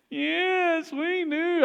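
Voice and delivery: put-on high, eager kid voice; earnest little boys. Boys swearing to dad they mean it, earnest. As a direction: answer like an earnest child.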